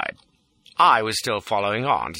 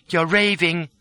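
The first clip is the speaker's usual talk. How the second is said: higher-pitched woman's cool dismissal; cold; 8 semitones higher, slower than usual. A narrator's reading. Lola to the desperate fugitive, coldly dismissive. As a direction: dismiss him without sympathy. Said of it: "sitting there cold and beautiful".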